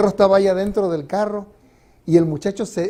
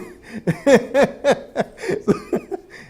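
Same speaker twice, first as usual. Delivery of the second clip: hearty laugh; 9 semitones higher than usual. Real, not acted. Laughing at the congregation.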